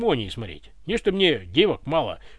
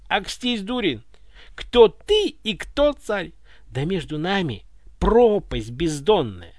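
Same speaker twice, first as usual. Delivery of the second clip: ordinary delivery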